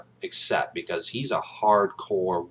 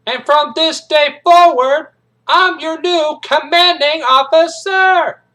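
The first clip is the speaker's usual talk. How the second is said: hard-core Marine bellowing his introduction; high, loud, slow drill-sergeant shout; Major Payne; 13 dB louder, much slower than usual. Acted. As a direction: announce yourself slowly at full parade-ground volume. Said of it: "he's introducing himself".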